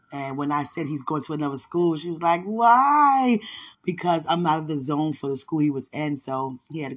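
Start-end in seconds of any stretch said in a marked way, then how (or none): 2.4-3.4 high, loud startled why; a sharp surprised cry of why; teacher's shocked why. Retold or imitated. Teacher surprised son leaves school.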